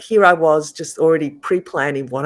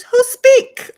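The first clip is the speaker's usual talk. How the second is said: loud, high-pitched Italian mum; imitated Italian accent, pitched up and loud; 9 dB louder than usual. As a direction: demand it like a suspicious Italian mother. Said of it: "Through Italian accent".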